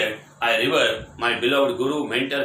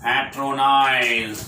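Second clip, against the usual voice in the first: slow headword; 4 dB louder, much slower than usual. Shown as a demonstration. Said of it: "new word introduced".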